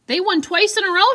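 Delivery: high, loud cheer; excited exclaim. Shown as a demonstration. Teacher voicing Sarah cheering about Long Beach, high and loud. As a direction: exclaim it like great news. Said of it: "You notice how my voice changes when things have quotations".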